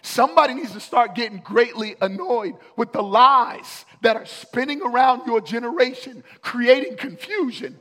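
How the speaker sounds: loud, high, driving preacher's cry; rally the room, pounding each somebody; full-force preaching. Acted. Preacher calling the church to get annoyed with darkness, loud and high.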